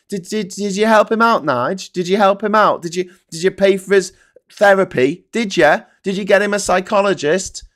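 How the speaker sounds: loud, high badgering questions; mock interrogation. Pretend grilling of Nigel about the pilot, loud badgering. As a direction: grill him relentlessly.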